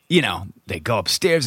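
normal talking voice

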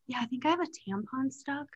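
embarrassed quiet. Retold or imitated. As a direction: say it quietly, hoping nobody hears. Embarrassed confession to receptionist.

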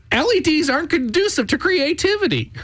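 high whiny complaining voice; imitating Chris. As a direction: pitch it way up and whine. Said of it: "He, he walking around".

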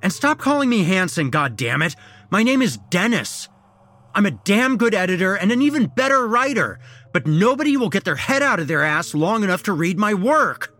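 high, shouted tirade; explosive anger. A narrator's reading. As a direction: let it all out at full voice. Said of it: "I exploded".

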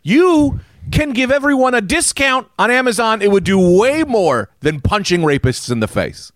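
very high, indignant mock rant; exasperated scolding. Public scolds billionaire about charity, high exasperated rant. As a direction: plead the obvious in a high, worked-up voice.

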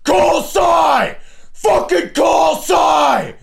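very high, loud yell; losing it at a phone that won't listen; screaming at the phone. Retold or imitated. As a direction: scream the command in total frustration. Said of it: "sitting in my car going".

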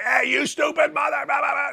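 high-pitched yelled insult trailing into gibberish; mock yelling. Coach wrongly yelling back at the guy, mock angry. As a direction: pretend to yell back angrily. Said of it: "You can't go yelling back at the guy".

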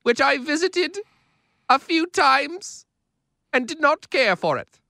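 lightly voiced Queen Elizabeth; slow, lofty royal voice; Queen impression. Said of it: "our best impressions of the Queen".

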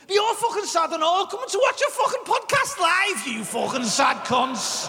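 mock insult. Real, not acted. Mock-insulting live audience.

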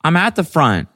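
high, loud mimicked friend; high-pitched, insistent friend voice; Bridget on the phone. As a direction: imitate an oblivious friend on a call. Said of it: "she was like".

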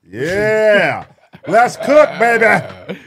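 loud, high hype with a laugh; hyped shouting. Real, not acted. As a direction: pump yourself up, shout it out. Hyped up about the race poll.

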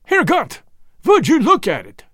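high, loud horrified exclamation; aghast, accented outburst; horror. A narrator's reading. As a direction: cry out in horror with a Germanic accent. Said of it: "The horror in Jan's voice".

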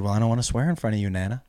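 low, soft, hurried retold admission; nervous confession. Grandchild backstage admitting fear of swearing to grandmother, nervous and hushed. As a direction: quietly confess it, a little rushed. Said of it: "I was really nervous".

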